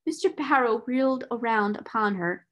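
ordinary delivery

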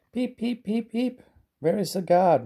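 very high whistling peep; whistle-like peeping. A narrator's reading. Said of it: "whistled Thomas".

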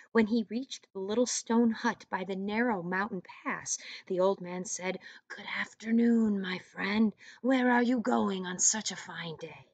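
put-on reading aloud to children; storyteller voice for young kids; story narration. A narrator's reading. Teacher reads story to class.

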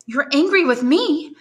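stunned that he is angry with her; astonished young granddaughter; astonished cry. Acted. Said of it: "cried Valentine, astonished".

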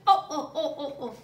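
chuckling oh oh oh; sheepish giggle; laughing at himself. Acted. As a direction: laugh off the wrong noise. Elephant laughs at mistake.

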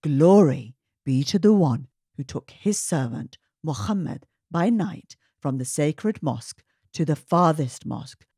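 ordinary delivery

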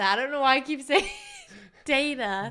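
fast, laughing through it; laughing at her own slip. Real, not acted. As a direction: giggle as you say it.

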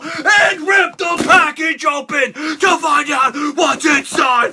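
battle yell; loud, high, raspy wrestling promo; warrior impression. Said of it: "a little bit of a warrior impression".

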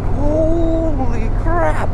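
high, drawn-out stunned gasp of words; retold wide-eyed holy crap; amazed exclamation. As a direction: let it stretch out in disbelief. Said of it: "I'm just like".

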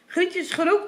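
ordinary delivery

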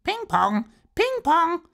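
hoch und laut krächzend wie ein Papagei. Acted. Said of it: "Einen Papagei, der". (schriller Papageienruf)

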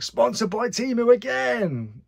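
high, slow, drawn-out taunt; teasing, stretched-out mimicry; mock jeering commenter. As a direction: imitate a heckler calling it out in a high voice. Mocks a commenter's recurring jibe.

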